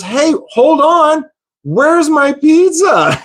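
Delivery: high, slow, drawn-out mock demand; slow-motion indignant customer, hammed up; confused customer. Confused customer demands missing pizza.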